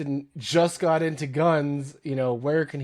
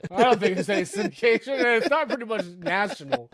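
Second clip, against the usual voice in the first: higher, fast laughing teasing; 7 semitones higher, faster than usual. Real, not acted. Teasing his co-host about syndication, laughing fast and high. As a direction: talk through the giggles. Joking about podcast reach.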